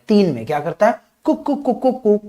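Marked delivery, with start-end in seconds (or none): 1.2-2.2 high, repeated little coos; bird-like baby cooing. Shown as a demonstration. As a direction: coo like a chirping bird. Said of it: "चिड़िया बन जाता है".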